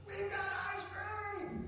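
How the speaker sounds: put-on very high-pitched, drawn-out child's holler; excited kid's yell. Birthday kid yells to all the other kids, excited high-pitched imitation. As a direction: imitate a thrilled kid yelling out news. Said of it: "He yells at all the other kids".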